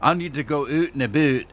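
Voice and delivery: oot and a boot said lightly, oot only half rounded; a gentle Canadian lilt on oot and a boot; subtle Canadian accent. Shown as a demonstration. Showing viewers how real Canadians say it, in a subtle Canadian accent. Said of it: "they don't exaggerate it like I did".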